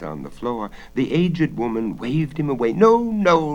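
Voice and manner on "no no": hoarse rasp. Acted. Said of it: "rasped the hoarse voice".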